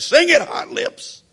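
high, loud, slow cheeky shout; loud boyish call to the singer; child hollering. Retold or imitated. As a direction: holler it across the church like an impatient kid. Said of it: "he just hollered out loud".

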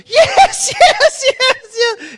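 mock-tearful, very high squealed yeses; hammed-up shriek of a delighted fiancée; overjoyed acceptance. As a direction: imitate her ecstatic, loud high squeal. Acts fiancée tackling him, accepting, crying.

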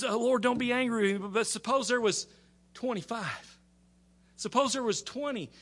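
high, insistent pleading; Abraham pleading. Retold or imitated. Abraham begging the Lord not to be angry, high insistent plea. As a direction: plead high and keep pushing the bargain. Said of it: "he's gotta be aggravating the Lord".

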